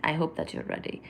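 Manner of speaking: soft, breathless whisper of the same line; hushed teasing whisper. Shown as a demonstration. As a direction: whisper it close to the mic. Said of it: "repeated in a whisper".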